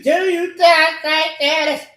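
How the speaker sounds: slow, high, loud chant-like shout; shouted outburst. Real, not acted. Speaker bursting out mid-rant, shouting slowly. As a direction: draw the question out at a yell.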